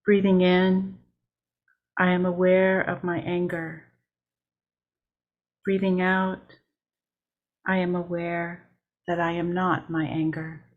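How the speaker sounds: measured meditation read with long pauses between lines; steady mindful guiding voice, line by line; guided meditation. Acted. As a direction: lead the breathing lines unhurried, letting each settle. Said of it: "I will begin with a guided meditation".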